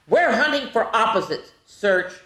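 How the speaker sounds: put-on bright read-aloud for kids; storybook reading. Teacher reading a picture book to the class, bright storybook voice. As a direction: read it like to little ones.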